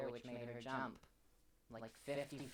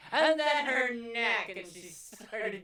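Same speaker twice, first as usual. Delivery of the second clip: high, loud slow squawk; shrill cartoon shriek; 9 semitones higher, 16 dB louder, slower than usual. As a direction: shriek it out slowly. Shrieking narration.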